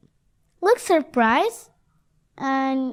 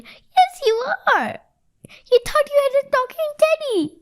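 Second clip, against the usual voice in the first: put-on high, excited yappy little boy; squeaky child gleefully insisting; yapping; 14 semitones higher, 3 dB louder than usual. A narrator's reading. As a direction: yap it fast and very high. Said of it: "yapped Eddie".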